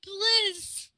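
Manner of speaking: high, loud mock wail; hammed-up begging. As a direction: beg dramatically, stretching it out. Begging again.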